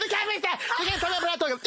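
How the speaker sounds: very high mock shriek; frantic argument. Bickering partners seconds before the show, frantic screeching. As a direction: screech it in a hammed-up row.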